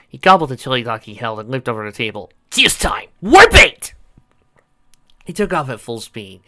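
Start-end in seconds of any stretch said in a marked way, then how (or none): 2.5-3.9 high, loud battle cry; shouting. Acted. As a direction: yell it as he charges. Sonic charging off.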